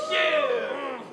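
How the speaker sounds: loud hype yell to the crowd; hype shout. Real, not acted. Rapper hyping the crowd, a loud yell.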